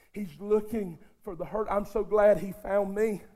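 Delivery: ordinary delivery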